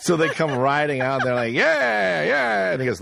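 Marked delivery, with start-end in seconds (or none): 1.5-2.7 high, drawn-out cheer; playful high-pitched rallying cry; mock cowboy whoop. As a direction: whoop like riders charging into town. Acts out riders coming into town.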